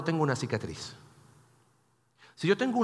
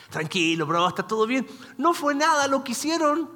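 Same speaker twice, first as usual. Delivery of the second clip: mock nonchalance; 8 semitones higher, 3 dB louder than usual. Parodies Joseph brushing off the hurt.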